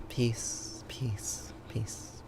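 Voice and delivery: fading to a whisper. Acted.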